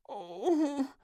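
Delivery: put-on a girl with a too-full tummy; whiny little groan; groaning princess. A narrator's reading. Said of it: "she groaned".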